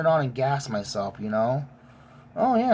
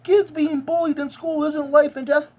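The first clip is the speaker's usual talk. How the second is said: mocking quote; 10 semitones higher, 4 dB louder than usual. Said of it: "before you go saying".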